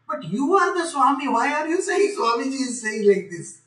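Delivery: lightly acted protest; mock challenge. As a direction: question it as if baffled. Acts out a challenge to swamis.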